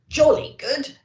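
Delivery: chuckling Santa. Acted. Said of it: "chuckled Santa".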